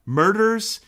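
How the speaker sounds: high, loud eager "Murders?"; mock-excited yes to murders; excited punchline. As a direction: blurt it out, delighted. Punchline of meme quote.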